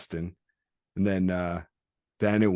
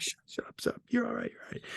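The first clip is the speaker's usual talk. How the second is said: whispery, hurried reassurance; mock hushing; 7 dB quieter, faster than usual. Friend quieting the patient during stitching, hushed mock soothing. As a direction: hush him under your breath.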